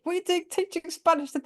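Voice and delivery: cheeky pupil. Retold or imitated. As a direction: voice the kids catching her out. Pupils question the teacher.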